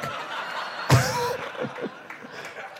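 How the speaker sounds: squealing falsetto laugh; high shrieking laugh. Real, not acted. Laughing at own bit.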